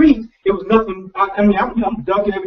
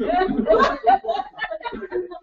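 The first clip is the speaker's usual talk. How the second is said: laughing; 12 semitones higher, 5 dB quieter than usual. Real, not acted. Laughs at his own joke.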